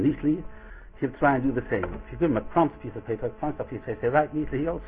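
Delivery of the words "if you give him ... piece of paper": ordinary talk, nothing marked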